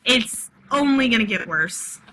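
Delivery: plain reading voice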